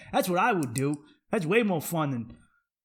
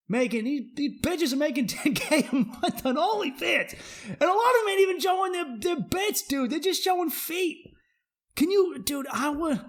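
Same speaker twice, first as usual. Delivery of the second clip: incredulous rant; 11 semitones higher than usual. Real, not acted.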